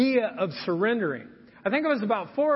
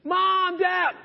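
put-on higher, louder child calling out; a small boy calling his parents for help, a higher, louder mock yell; 9 semitones higher, 4 dB louder than usual. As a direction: call for help like a pinned kid. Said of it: "I started yelling".

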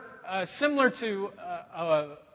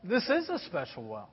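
soft, tender address, much quieter; gentle Jesus; 4 semitones lower, 8 dB quieter than usual. Retold or imitated. Jesus looking deep into her soul, softly gentle. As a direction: draw the listener in softly. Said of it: "her attitude starts slowing down a little bit".

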